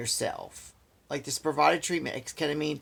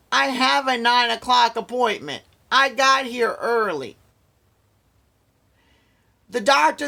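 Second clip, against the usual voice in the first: higher, louder, exasperated venting; indignant rant; 7 semitones higher, 4 dB louder than usual. Real, not acted. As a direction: let the frustration pour out. Angry rant about the treatment.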